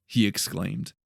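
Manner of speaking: ordinary delivery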